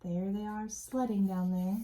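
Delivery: ordinary talk, nothing marked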